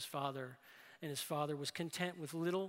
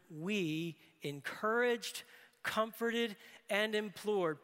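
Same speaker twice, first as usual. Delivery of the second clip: each word laid down slowly, one at a time; slow deliberate reading; 4 semitones higher, 4 dB louder, much slower than usual. Shown as a demonstration. Preacher reading the verse to the congregation, slow and deliberate. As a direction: read the three verbs slowly, letting each land. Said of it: "Preachers love groupings of three".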